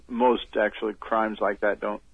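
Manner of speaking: ordinary talk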